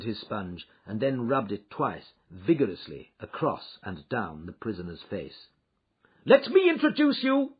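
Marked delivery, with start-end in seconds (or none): 6.2-7.5 triumphant shout, much higher and louder. A narrator's reading. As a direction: announce it loudly, like a reveal. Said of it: "he shouted".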